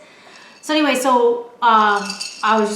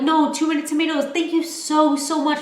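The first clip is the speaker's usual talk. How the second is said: overly polite refusal; 6 semitones higher, 3 dB louder, faster than usual. Retold or imitated. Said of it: "we were like".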